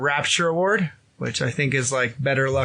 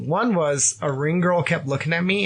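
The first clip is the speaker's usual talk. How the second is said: ordinary talk, nothing marked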